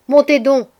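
high, loud call to come up; shouted order. A narrator's reading. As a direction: call out sharply to servants in the yard. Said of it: "criai-je à nos deux servantes".

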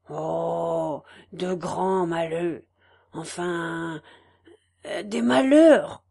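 low, slow and firm, closing the subject; mild knowing finality; curt final tone. Acted. Farmer's wife closing the subject of the misfortunes, curtly. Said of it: "conclut la fermière d'un ton net".

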